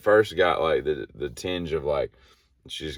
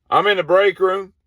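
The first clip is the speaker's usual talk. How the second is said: high, loud reply over the radio; 11 dB louder than usual. Retold or imitated. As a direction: answer back on the walkie-talkie. Voice over speakerphone.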